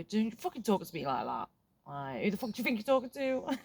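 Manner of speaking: put-on sharp, angry snap at a rude kid; snapping back angrily. Support worker imagining snapping back at a hostile kid, angry. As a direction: bite back with a hammed-up outburst. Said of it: "it's not helpful for me to snap back and go".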